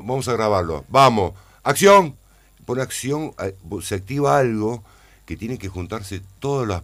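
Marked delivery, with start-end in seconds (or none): 0.0-2.1 loud, raised rallying call; director calling action. Acted. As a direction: fire up the set, building to a shouted cue. Acts out the call to shoot. (grito de director dando la orden de rodar)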